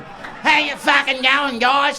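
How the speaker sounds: loud, drawn-out hammed-up Aussie; broad Australian accent. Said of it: "My accent gets fucking broader".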